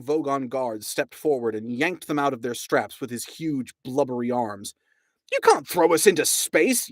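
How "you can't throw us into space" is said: raised, pleading yell; frantic yell. A narrator's reading. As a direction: protest loudly as you're dragged off. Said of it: "yelled Ford".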